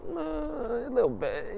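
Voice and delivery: very high, slow coy admission; wife's voice. Retold or imitated. Wife admits marrying for money, high coy voice. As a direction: play it sheepish and squeaky.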